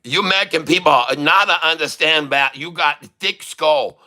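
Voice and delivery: mock-indignant fake Chinese accent. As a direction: scold in a put-on Chinese accent. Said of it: "I'll talk like a Chinese".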